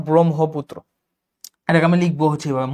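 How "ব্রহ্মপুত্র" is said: ব্রহ্মপুত্র with m before h; pronunciation demo. Shown as a demonstration. Teacher showing m-plus-h, ব্রহ্মপুত্র as brom-ho. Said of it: "সেই জন্য এটাকে বলবো".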